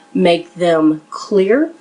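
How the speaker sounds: ordinary talk, nothing marked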